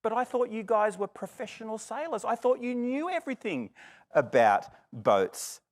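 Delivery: a touch of teasing sarcasm; lightly mock the proud sailors; mild sarcasm. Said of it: "I might be a little sarcastic and say".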